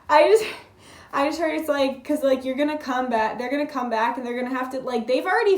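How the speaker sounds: loud, excited rush of words; excited outburst. Real, not acted. Reader fired up by the cards, talking loud and excited. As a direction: blurt it out, thrilled.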